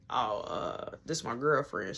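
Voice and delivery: low, soft, halting answer; retold reluctant mumble; sheepish admission. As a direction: act out being caught out.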